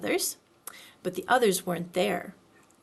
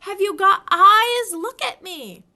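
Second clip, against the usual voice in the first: shout; 10 semitones higher, 10 dB louder than usual. A narrator's reading. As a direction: yell in frustration. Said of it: "shouted Piglet".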